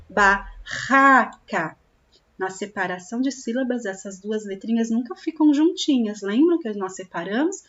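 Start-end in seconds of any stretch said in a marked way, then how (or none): 0.1-1.7 Barraca slowly and louder, RR rasped in the throat; strong double R. Shown as a demonstration. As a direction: push the rough RR in Barraca. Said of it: "Quando eu juntar esses dois R's que ele fica forte".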